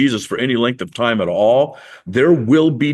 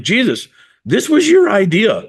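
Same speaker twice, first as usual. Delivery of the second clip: high-pitched indignant accusation; a little bit mad, played up; mock exasperation; 10 semitones higher, 3 dB louder than usual. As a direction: throw the blame back, acting put out. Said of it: "I think they're a little bit mad".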